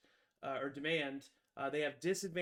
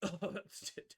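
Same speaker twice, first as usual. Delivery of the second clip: ordinary talk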